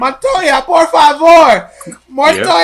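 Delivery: high, drawn-out pleading yell; mock host yelling. Host yelling after the man on the beach, mock yelling. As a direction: imitate a host yelling, stretching the words. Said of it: "host kept yelling".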